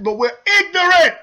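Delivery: sudden high loud yell of frustration; frustrated outburst. Real, not acted. Ranting to viewers about his own people, yelling in anger. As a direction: spit it out, fed up. Angry rant about community.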